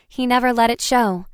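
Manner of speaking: usual speaking voice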